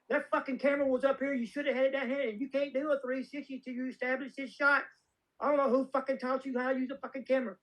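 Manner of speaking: put-on high, scolding little old lady voice; Renee Valente. Old producer faulting a director's camera work, high scolding impression. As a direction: imitate a tiny old producer chewing someone out. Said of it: "this is exactly how she said it".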